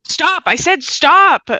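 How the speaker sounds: sharp high yell to stop them; angry shout. Acted. Phoebe finally snaps.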